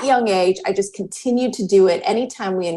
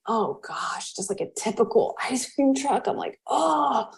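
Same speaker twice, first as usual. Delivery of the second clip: much softer, deflated disbelief; hushed dismay at the ice cream truck; stunned quiet; 3 semitones higher, 6 dB quieter than usual. Retold or imitated.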